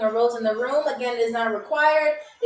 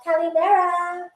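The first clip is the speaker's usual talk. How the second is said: high singsong hello, second one much higher and drawn out; woman's greeting; 6 semitones higher, slower than usual. Shown as a demonstration. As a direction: playfully swoop up into a girly lilt. Repeats the woman's demo greeting.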